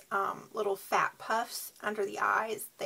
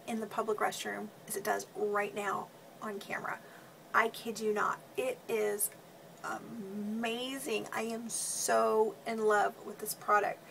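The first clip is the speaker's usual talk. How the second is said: ordinary talk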